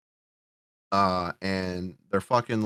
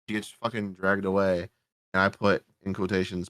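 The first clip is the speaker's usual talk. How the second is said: normal talking voice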